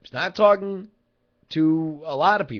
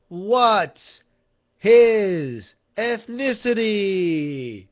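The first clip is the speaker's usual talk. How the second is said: drawn-out, higher game show host call; game show title announcement; 4 semitones higher, much slower than usual. Acted. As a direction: announce a quiz title, stretching every word.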